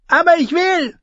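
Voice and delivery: put-on high pouting little boy; young boy voice. A narrator's reading. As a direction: insist like a stubborn child.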